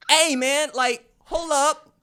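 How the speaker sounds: loud, high-pitched plea of bafflement; exasperated retold appeal; mock protest. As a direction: protest as if stumped by the spelling. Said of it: "and you say".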